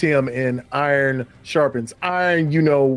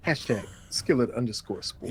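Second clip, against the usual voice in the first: ordinary talk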